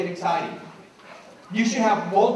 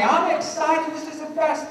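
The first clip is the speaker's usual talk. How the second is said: high-pitched, enthusiastic gush; excited teacher; 9 semitones higher than usual. Shown as a demonstration. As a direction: sell the excitement to the class, pitch way up. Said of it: "How much excitement, how much enthusiasm".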